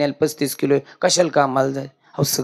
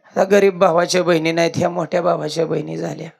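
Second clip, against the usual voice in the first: ordinary talk, nothing marked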